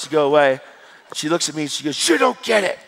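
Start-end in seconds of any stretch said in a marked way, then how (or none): 2.1-2.8 high, loud exasperated mock yell; imitating angry woman. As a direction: throw it out like a furious woman being quoted. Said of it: "And she's so angry".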